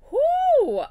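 loud, high-pitched "whoo"; big whoop. Real, not acted. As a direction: shake it off with one piercing whoop. Shaking off the last video.

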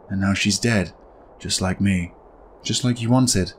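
the dead wife quietly accusing; soft, icy blame; cold ghostly accusation. Acted. Wife's accusing lines.

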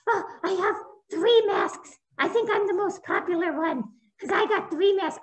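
high, boastful puppet voice; a proud little puppy showing off; bragging puppy puppet. Acted.

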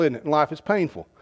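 ordinary delivery

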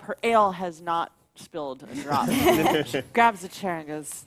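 usual speaking voice

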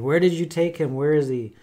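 mary pleading. Retold or imitated. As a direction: cry out searching for the body. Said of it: "pounding on his chest saying".

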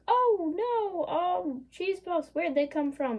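high, loud mock innocence; a kangaroo pretending not to know about the cheese puffs; guilty kangaroo. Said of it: "And the kangaroo's like".

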